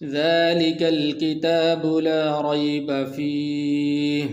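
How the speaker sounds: long drawn-out chanted recitation; Quran recitation. Shown as a demonstration. Teacher reciting for students with drawn-out tajweed chant. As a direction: chant it slowly, holding the long vowels.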